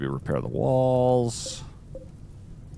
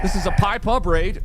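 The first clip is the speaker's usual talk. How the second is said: shout; 8 semitones higher, 5 dB louder than usual. Real, not acted. Shouting raid announcement.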